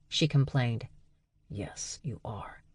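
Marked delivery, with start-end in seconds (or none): 1.5-2.6 soft whisper. Acted. Dag taking the wine away, whispered. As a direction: whisper it firmly. Brother quietly overrules sister.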